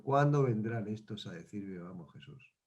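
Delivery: Jesus dozing in the boat, murmuring drowsily; sleepy Jesus. Acted. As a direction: mumble it half asleep. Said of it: "más dormido estaba diciendo".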